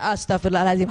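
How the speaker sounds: the husband finally gives in, low; husband calming down on the fifth istighfar; husband joining istighfar. Retold or imitated. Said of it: "baru tuh yang kelima dia".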